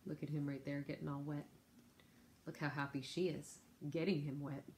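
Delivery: plain reading voice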